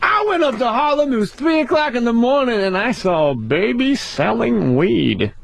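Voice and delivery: high stand-up setup, slowing into the punch; hammed-up impression of a stand-up storyteller; Dave Chappelle. Comic imitating a rival's Harlem bit, exaggerated storytelling voice. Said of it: "this is Dave Chappelle".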